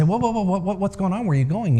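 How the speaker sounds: alarmed leaders. A narrator's reading. Leaders chase the old man.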